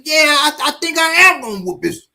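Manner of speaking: high, hammed-up threat with shouted bits; threatening young man. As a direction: brag to the stranger about beating the old man.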